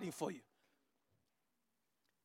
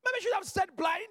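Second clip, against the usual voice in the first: ordinary talk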